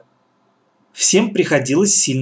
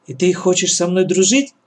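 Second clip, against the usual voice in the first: ordinary delivery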